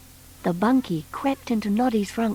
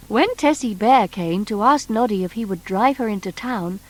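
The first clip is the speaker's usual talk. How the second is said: ordinary delivery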